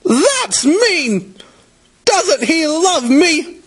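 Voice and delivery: put-on very high-pitched, hurt little-kid protest; child's shout. A narrator's reading. Casey asking her mother about Daddy, high upset outcry. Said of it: "Casey shouted".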